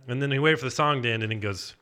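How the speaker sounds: ordinary delivery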